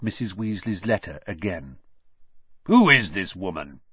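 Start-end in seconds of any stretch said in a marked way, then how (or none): 2.6-3.8 louder, disgusted question; with distaste. Acted. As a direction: sneer at the name on the letter. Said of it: "staring at the signature with distaste".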